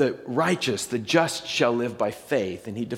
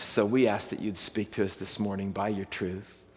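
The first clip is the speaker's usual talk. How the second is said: prayer; 4 semitones lower, 3 dB quieter than usual. Acted. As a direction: pray quietly and reverently, winding down. Pastor prays before the passage.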